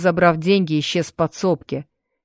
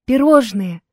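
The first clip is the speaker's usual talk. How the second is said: delighted; 5 semitones higher than usual. Acted. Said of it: "восторженно ответила девушка".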